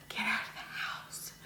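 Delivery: breathy wistful whisper. Real, not acted. Mum stuck in lockdown whispering her wish, whisper. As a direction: whisper it like a longing.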